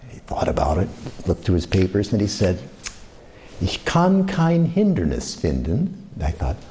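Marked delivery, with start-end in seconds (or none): 3.6-5.9 slow, lightly voiced German clerk. Railway clerk refusing a senior discount, slow German. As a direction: read it out like a by-the-book official.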